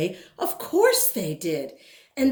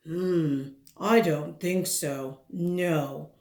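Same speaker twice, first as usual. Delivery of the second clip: a hint of a lower, dismissive landlord; lightly voiced landlord; 4 semitones lower, slower than usual. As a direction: refuse curtly, slightly lower. Landlord refuses.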